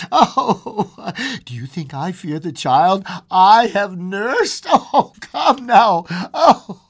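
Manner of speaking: high, loud, broken plea; crying insistence. A narrator's reading. Old woman dragging husband to the door, high and crying. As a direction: insist through sobs.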